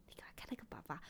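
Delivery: hushed, breathy aside to a friend; urgent whisper telling a friend to look. Retold or imitated. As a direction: whisper so the dad won't hear. Urging someone to look, secretly.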